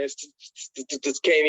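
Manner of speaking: re-enacted stutter on the first sound; imitating tongue-tied stammering. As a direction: get stuck on the S, then push it out. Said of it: "I'm, I'm stuttering".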